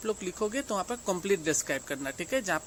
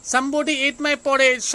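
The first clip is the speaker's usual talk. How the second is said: slow, loud and higher papa bear shout; shouted in a big gruff voice; 6 semitones higher, 9 dB louder, much slower than usual. A narrator's reading. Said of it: "shouted Papa Bear in the big gruff voice".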